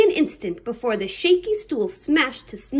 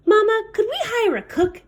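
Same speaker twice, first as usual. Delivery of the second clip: higher little pirate voice; high child voice; 8 semitones higher than usual. Acted. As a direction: ask like a small child. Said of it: "Baby asked".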